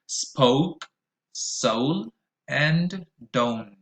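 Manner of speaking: put-on "Oat", "old", "ocean" said slowly with a clear rounded O diphthong; model words, repeat after me. Shown as a demonstration. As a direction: read each word slowly for students to repeat. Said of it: "Repeat after me".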